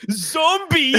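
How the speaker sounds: high, loud hammed-up punchline; dramatic tagline reveal. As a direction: burst out with the big reveal.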